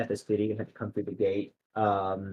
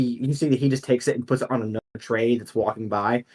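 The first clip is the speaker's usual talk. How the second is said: normal talking voice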